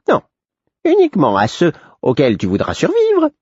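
high, sly jester warning; high, cryptic fool; the Fool's voice. A narrator's reading. The Fool warns.

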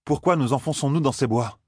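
high-pitched pressing question; insistent questioning. A narrator's reading. Former wise woman questioning their silent guide, insistent high voice. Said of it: "insista l'ancienne sage-dame".

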